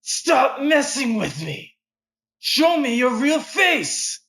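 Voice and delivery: high, slow, furious shouting; cowboy screams at the assassin to show himself; rage. Acted. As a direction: bellow it out in pure rage.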